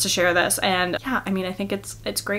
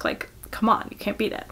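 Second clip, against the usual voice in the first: breathy whispered aside to the camera; a playful whisper, almost conspiratorial; 9 dB quieter than usual. Real, not acted. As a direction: drop to a hush, as if sharing a secret.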